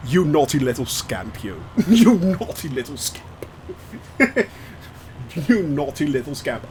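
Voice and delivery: low, playful telling-off; mock scolding. Streamer teasing a chatter for misbehaving, low mock scolding.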